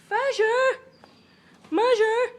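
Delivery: loud high call to the birds. Real, not acted. Calling out loud to the pet birds.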